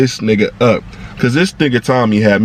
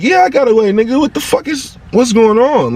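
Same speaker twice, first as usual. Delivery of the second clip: frantic reply; 11 semitones higher than usual. Retold or imitated. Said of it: "I'm like".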